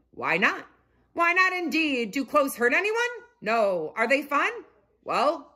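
put-on high, stately old mole rat; wise grandpa speech. A narrator's reading. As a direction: address the colony warmly.

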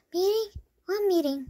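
plain reading voice

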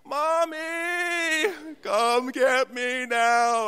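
put-on high, loud childish wail, slowing; hammed-up terrified child calling for mom; crying like a baby. Pastor stuck on the fire tower, wailing like a baby. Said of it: "crying like a baby".

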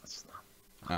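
breathy menacing whisper. Acted. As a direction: hiss it under the breath. Mock threat, whispered.

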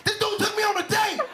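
high-pitched comic outrage; shocked realisation. Acted. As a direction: blurt it out as it dawns on you. Acting out sudden shocked realisation.

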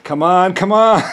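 will it to work; anxious urging. Retold or imitated. Watching his accounts drain, urging tensely.

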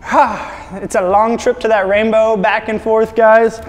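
put-on a tired leprechaun back from the rainbow in an Irish accent; a hammed-up Irish accent. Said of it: "a terrible Irish accent".

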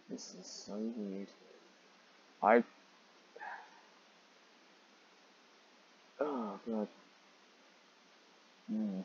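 soft, disturbed aside; uncomfortable murmur. Real, not acted.

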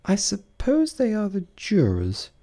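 put-on high, slow girlish voice of Alice; a young girl proud of a new word; Alice musing. A narrator's reading. Alice muses in story.

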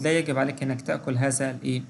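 ordinary talk, nothing marked

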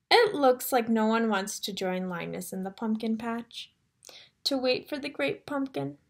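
ordinary delivery, nothing marked

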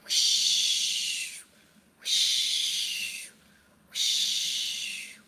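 breathy whoosh of bat wings; soft airy whooshes; whooshing wind sound. Shown as a demonstration. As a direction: blow it out like flying wings. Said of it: "Let's fly".

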